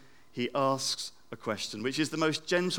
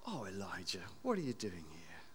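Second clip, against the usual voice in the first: much softer, lower tender question; gentle tender; 5 semitones lower, 15 dB quieter than usual. Acted. God gently asking Elijah, soft and tender. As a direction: ask it tenderly, like a loving father. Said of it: "Same question".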